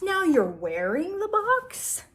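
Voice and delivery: very high, slow, exaggerated disbelief; drawn-out, swooping storybook question; hammed-up incredulity. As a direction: play the baffled grown-up for kids. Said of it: "I would ham it up".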